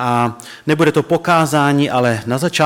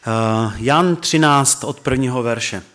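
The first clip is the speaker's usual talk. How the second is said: ordinary talk, nothing marked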